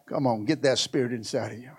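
fast urgent whisper; hushed quick urging to the congregation. Real, not acted. Preacher hushes to intimate urging.

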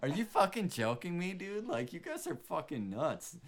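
high disbelieving outburst; incredulous. Real, not acted. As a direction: ask in total disbelief. Incredulous rant about episode.

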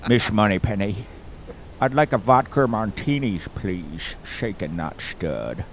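mock Scottish spy, slushy s's; Sean Connery. Ordering from Miss Moneypenny, mock Scottish spy. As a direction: start level, sink low on the order. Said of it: "Sean Connery is, uh, the Scotsman".

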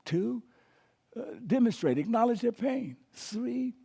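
ordinary talk

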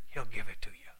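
whispered promise, unvoiced. Real, not acted. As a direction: lean in and whisper it.

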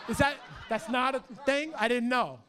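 laughing loudly through the words. Real, not acted. Pastor laughing off his own joke with the crowd. Joke about fake announcement.

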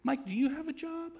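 very high, loud southern lady voice; imitating Alabama mentor. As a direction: mimic an old mentor from Alabama quizzing you. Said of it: "and she'd say".

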